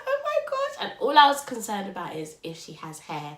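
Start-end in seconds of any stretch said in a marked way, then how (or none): 0.0-0.8 very high, breathless delight; excited gasp. Retold or imitated. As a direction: gasp it out, thrilled. Hearing head is visible.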